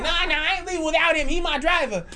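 fast, high, loud mock shrieking; hammed-up falsetto protest; imitated screaming. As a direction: imitate a frantic woman resisting security. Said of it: "Lady was screaming".

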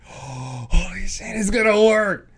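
loud, high, slow amazed cry; excited disbelief. Retold or imitated. Crew realizing the prank works, shouting in amazement. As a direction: gasp then shout it in wonder.

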